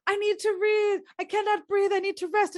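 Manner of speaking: mock Nurse. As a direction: pretend to gasp for rest. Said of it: "And she's like".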